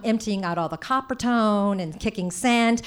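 ordinary talk, nothing marked

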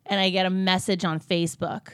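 normal talking voice

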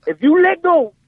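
high, insistent impression of dad's warning; threatening dad. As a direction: lay down the threat as an intense father. Retold dad threatening his son.

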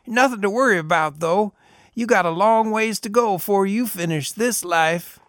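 high tinny voice, amused and easy; chuckling reassurance. A narrator's reading. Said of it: "with a chuckle".

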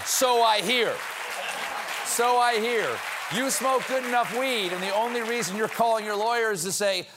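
high, loud scolding rant. Acted. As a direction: keep yelling at them. Lecturing stoners.

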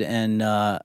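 ordinary talk

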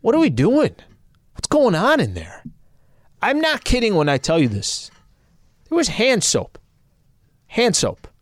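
high, amazed disbelief; can't believe the price; incredulous. Real, not acted.

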